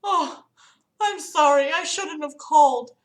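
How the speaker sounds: put-on high-pitched apologetic old lady. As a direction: imitate a frustrated older caller apologizing in a high voice. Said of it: "it was just this old lady".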